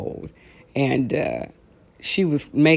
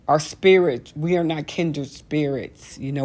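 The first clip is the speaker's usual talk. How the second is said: ordinary talk, nothing marked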